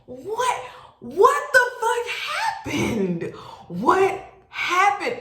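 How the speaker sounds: high, slow, stretched disbelief; hammed-up incredulous squeal; bewildered outburst. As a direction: act out total bafflement, voice way up. Acting out bewildered reaction to accusation.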